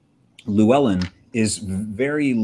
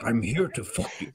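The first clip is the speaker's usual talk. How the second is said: wizard voice dropped softer for a punchline; mock-solemn old wizard, much quieter; Gandalf; 7 dB quieter than usual. Joking twist on the wizard's line, mock solemn. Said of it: "Can you talk more like Gandalf".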